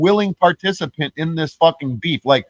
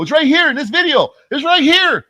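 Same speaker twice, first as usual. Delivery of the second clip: high-pitched, pleading mock protest; mock insistence; 10 semitones higher than usual. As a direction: imitate an accuser insisting, voice pitched way up. Acting accuser insisting on his proof.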